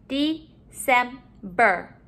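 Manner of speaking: "December" slowly, in three beats; syllable by syllable. Shown as a demonstration. Teacher modeling syllables to put together, broken up. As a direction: split "December" into separate syllables. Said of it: "let's put our syllables together".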